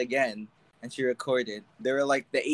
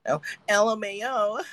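higher, laughing imitation of her giggle; mocking her laugh; 8 semitones higher, 3 dB louder than usual. Teasing co-host by imitating her laugh, laughing and higher. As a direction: parody her laugh as you say it. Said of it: "I don't sound like that".

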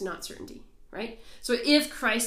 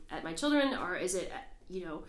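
ordinary delivery

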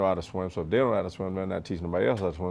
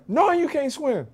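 drill instructor; slower than usual. Retold or imitated. Drill instructor questioning recruit.

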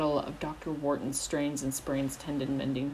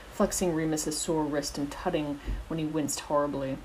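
ordinary delivery, nothing marked

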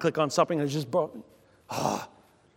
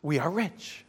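good news; 6 dB quieter than usual. Shown as a demonstration. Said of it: "So the good news is".

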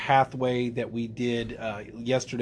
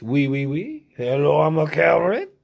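playful French vegetable voice, louder; mock French carrot; 3 semitones higher, 6 dB louder than usual. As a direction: put on a silly talking-carrot voice with French flair.

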